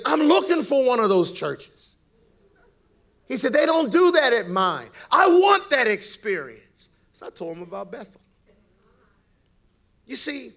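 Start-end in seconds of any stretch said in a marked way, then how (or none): 0.1-6.6 Russian man eager. Retold or imitated. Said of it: "he says".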